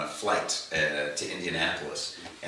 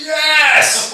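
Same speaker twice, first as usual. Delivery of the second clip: high, loud delighted shout; much higher, louder joyful cry; excited yes; 12 dB louder than usual. Retold or imitated. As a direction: blurt it out laughing with glee. Musician bursts out laughing, confirms.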